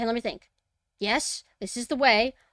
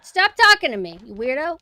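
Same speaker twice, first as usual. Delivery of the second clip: yelling; 3 semitones higher, 11 dB louder than usual. Real, not acted. Yelling at creepy voice.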